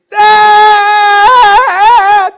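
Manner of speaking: put-on very high loud child's cry; child yelling Dad. Son stuck in a bucket calling his dad, shrieking. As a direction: wail it like a stuck little boy.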